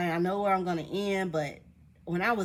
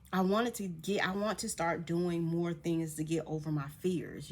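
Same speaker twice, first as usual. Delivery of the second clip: ordinary talk, nothing marked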